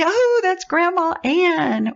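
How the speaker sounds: high, slow, delighted recognition; a family member lighting up as they recognise her; recognising grandma. Retold or imitated. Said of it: "And they'll say".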